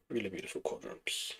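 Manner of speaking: soft unvoiced repeat; whispered echo. Shown as a demonstration. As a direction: breathe it back softly.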